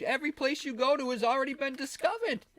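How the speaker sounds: ordinary delivery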